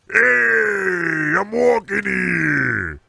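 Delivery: put-on high, loud, slow New York accent monster bellow. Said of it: "Can you give some of that New York monster accent".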